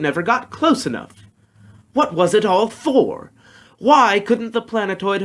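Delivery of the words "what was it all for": usual speaking voice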